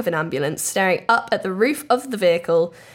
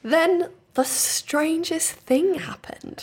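dramatic slow build; 8 semitones higher, much slower than usual. Acted.

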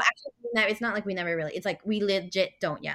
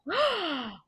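mock shocked gasp; 10 semitones higher than usual.

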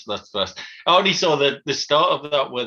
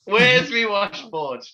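drawn-out, higher catchphrase with a laugh; Fast Show old fella; 5 semitones higher, 4 dB louder, slower than usual. Retold or imitated. Podcast guest recalling a Fast Show catchphrase, playful old fella impression. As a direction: slowly imitate the old comic's catchphrase, amused. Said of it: "the, uh, the old fella".

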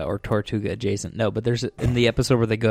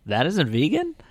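higher, rising baffled question; mock disbelief; 5 semitones higher than usual. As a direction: play innocent surprise, pitched up. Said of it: "And then he's like".

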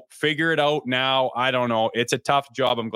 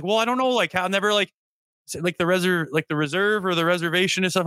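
higher-pitched, halting mock hesitancy; imitating timid trainer; 6 semitones higher than usual. Player imitates trainer awkwardly asking about the reserve, timid. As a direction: stumble awkwardly toward a touchy question. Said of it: "he's gonna be in timid".

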